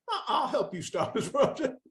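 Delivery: laughing through it. Retold or imitated. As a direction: laugh out the promise at yourself. Laughing at his own naive promise.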